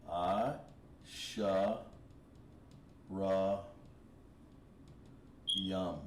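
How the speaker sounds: "I, sha, ra, yum" each alone between long pauses, pitched low; syllable-by-syllable demo. Shown as a demonstration. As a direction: sound out "I, sha, ra, yum" slowly while writing. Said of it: "It's like this".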